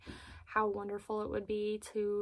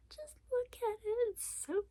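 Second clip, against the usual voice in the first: much higher, soft gushing; delighted squeak; 11 semitones higher, 5 dB quieter than usual. Real, not acted. Showing viewers the bronzer, soft delighted coo.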